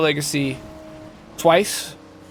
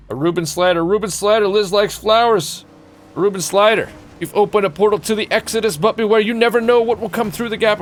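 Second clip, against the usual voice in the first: higher, louder raid announcement; hype announcer; 8 semitones higher, 5 dB louder than usual. Pretend. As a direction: herald the newcomer with mock-epic drama. Hypes an incoming raider.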